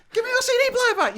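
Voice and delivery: high, loud, fast yell; shouting. Retold or imitated. Chasing the thief across the car park, high fast yell. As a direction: yell it after the fleeing thief.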